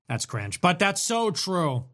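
normal talking voice

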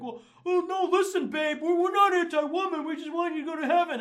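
high, loud mocking boyfriend voice; whiny mock excuse to a girlfriend; placating boyfriend. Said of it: "trying to excuse it to your girlfriend".

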